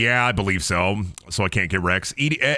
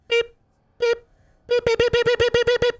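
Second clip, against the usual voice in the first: imitated beeps.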